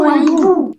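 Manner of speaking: "hibou" shrieked high and loud, falling; panicked squeal landing on "hibou"; mice crying out. A narrator's reading. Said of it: "s'écrièrent les souris".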